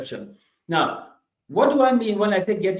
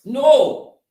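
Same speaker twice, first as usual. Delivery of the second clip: sharp no; 8 semitones higher, 9 dB louder than usual. Real, not acted.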